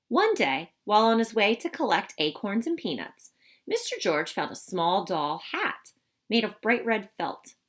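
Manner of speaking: lively, expressive storybook voice; reading to children. Acted. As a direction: read it to a class of young kids. Reads picture book to children.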